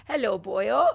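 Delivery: lightly imitated Welsh accent. Imitating dad on request, light Welsh accent. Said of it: "Can you do a Welsh accent?".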